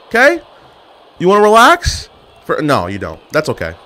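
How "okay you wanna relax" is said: yelling. Real, not acted. Yelling at wrestler in game.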